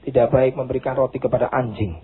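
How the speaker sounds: low stern pronouncement; sharp words. Acted. Preacher voicing Jesus' harsh reply to the mother, low and stern. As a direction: say it low and cutting. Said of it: "perkataan yang pedas".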